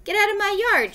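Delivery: high, cross shout for a character; storybook shout. A narrator's reading. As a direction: call it out high, ordering someone off. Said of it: "chatted Eugenia".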